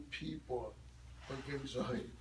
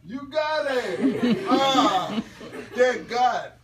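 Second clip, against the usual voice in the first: higher and louder, laughing with relief; delighted praise; 6 semitones higher, 11 dB louder, much slower than usual. Real, not acted. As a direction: cheer the learner on. Praising learner's success.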